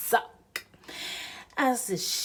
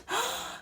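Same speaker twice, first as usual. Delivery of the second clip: sharp high gasp; 4 dB quieter than usual. A narrator's reading. Knot slipping suspense.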